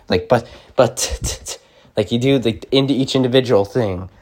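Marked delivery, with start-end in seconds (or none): 0.3-1.6 "but" with the final T sharply released; pronounced T. Shown as a demonstration. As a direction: isolate the T at the end of "but". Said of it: "just pronounce T's".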